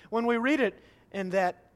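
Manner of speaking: ordinary delivery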